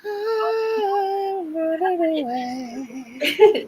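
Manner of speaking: high, slow pretend wail; hammed-up tearful whine; mock crying. Joking despair after a tech mishap.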